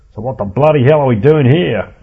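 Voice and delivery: Ed protesting at the pest control meeting, loud; loud Aussie grumble. A narrator's reading. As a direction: complain like a bull-necked Aussie. Ed complains at meeting.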